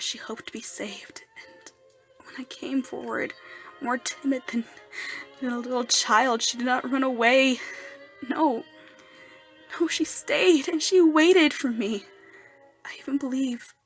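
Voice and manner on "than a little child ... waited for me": swelling, louder amazed voice; overwhelmed wonder. Acted. Erik telling Daroga she did not run away, swelling with wonder.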